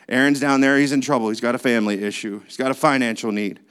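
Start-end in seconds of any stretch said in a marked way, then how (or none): none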